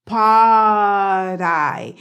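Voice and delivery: loud, drawn-out "Pod I" as one word with a flapped D; "Pod I" said like "paah-dai"; linked tapped D. Shown as a demonstration. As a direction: stretch "Pod" and glide into "I" on a tap. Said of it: "Se conecta el pod con la I".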